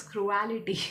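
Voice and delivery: cruelty as "cru-AL-ity"; mispronounced cruelty. Real, not acted. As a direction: say cruelty like reality, extra syllable. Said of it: "why am I pronouncing it as cruelty".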